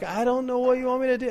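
high-pitched upset whine; upset kid. Acted. Upset kid answering an angry dad, high-pitched protest. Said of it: "upset, and they're like".

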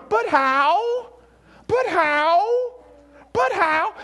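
high, drawn-out protest, rising in disbelief; protesting doubter. Acted. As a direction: act out a listener balking at God. Imagined listener protesting to God.

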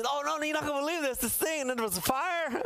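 high, fast, tumbling excuse; a husband rushing to explain his late night; eager husband. Retold or imitated. Husband excusing himself to his wife, high and fast. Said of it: "husband who comes home late with a story".

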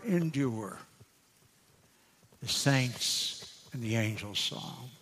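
hushed verses, trailing off at line ends; hymn recitation. Acted.

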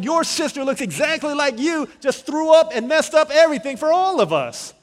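high, full-volume comic tirade; mad outburst. Retold or imitated. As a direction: rant furiously, hammed up for the story. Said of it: "I was so mad at her twin sister".

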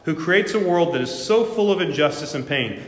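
a preacher acting out an atheist's furious tirade against God; outraged tirade. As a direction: hurl the accusation at God with mounting fury. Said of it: "He replied".

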